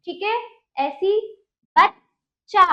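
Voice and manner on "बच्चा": बच्चा split as बच्…चा, louder; correct pronunciation demo. Shown as a demonstration. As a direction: stop on half च, then full चा in बच्चा. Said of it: "इसका उच्चारण क्या है?".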